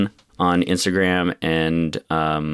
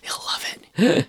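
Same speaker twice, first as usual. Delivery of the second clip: breathy teasing whisper; whispered aside; 13 semitones higher than usual. Real, not acted. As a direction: whisper it behind his back.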